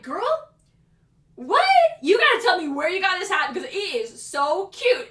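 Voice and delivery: put-on high, loud, incredulous girlfriend reaction; acted delighted disbelief; amazed gushing. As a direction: react astonished, then gush. Acted retold reply to customer.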